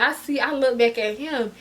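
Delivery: usual speaking voice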